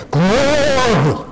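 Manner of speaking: high, drawn-out imitation of a fervent worshipper; Pentecostal exclamation. Preacher imitating his Pentecostal friends to the congregation, fervent exclamation. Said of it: "as my Pentecostal friends used to say".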